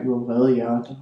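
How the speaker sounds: ordinary delivery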